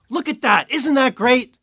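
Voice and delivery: high, loud, delighted exclamation; enthusiastic mentor. Retold or imitated. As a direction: point it out with eager wonder. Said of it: "and he goes".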